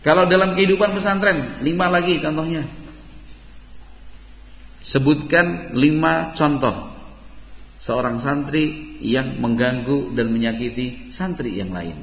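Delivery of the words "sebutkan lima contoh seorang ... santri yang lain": slow dictation. Real, not acted. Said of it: "teacher sets a question".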